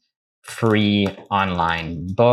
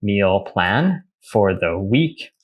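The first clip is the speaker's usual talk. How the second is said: slow dictation; slower than usual. Shown as a demonstration. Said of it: "So for example".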